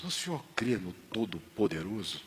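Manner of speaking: "o senhor crê no Todo-Poderoso?" asked softly and low; first ask of "crê no Todo-Poderoso?", gentle and hushed; quiet question. Retold or imitated. Father quietly asks seu Barros about his faith, soft.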